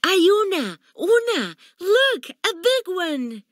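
put-on high, excited little child voice; proud proclamation. A narrator's reading. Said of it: "proudly proclaimed".

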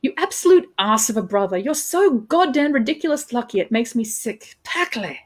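emphatic, exasperated insult climbing in pitch; acted sisterly exasperation; sibling disgust. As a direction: berate a brother with theatrical disgust. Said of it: "thick with sibling disgust".

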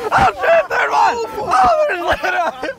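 high, excited shout; amazed shrieking. Real, not acted. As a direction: squeal in disbelief. Amazed as a third person jumps.

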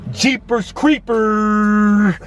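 very slow, drawn-out mock exclamation; drawn-out exclamation at the mileage; comic shock. Real, not acted. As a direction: stretch it out like a cartoon gasp.

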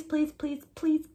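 plain reading voice

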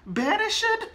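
high, sharp cry of disbelief; anguished cry. Acted. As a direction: shriek the word in horror. Romeo's outraged cry at word.

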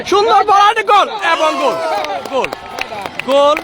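high, rising goal shout; excited goal call. Real, not acted. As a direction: call the goal with a burst of joy. Goal scored, commentator erupts.

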